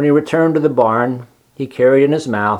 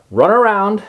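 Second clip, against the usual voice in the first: higher, firm command; 9 semitones higher than usual. Acted. Charlotte commanding Wilbur to run. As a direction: give the order. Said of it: "commanded Charlotte".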